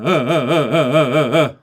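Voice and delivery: short loud ahs, each one kicked out by a fresh burst of breath; pulsed breath-impulse ahs. Shown as a demonstration. Teacher demos loud pulsed ahs to show breath impulse. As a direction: punch each ah out on its own push of air. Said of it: "those are all different things".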